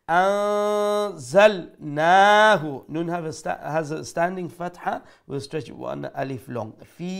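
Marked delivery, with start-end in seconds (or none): 0.1-2.8 slow chanted আনজালনাহু with hidden nasal; recitation demo. Shown as a demonstration. Teacher recites for students, slow Quranic chant. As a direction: draw out আনজালনাহু slowly, nun hidden. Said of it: "ইখফা করে পড়বো".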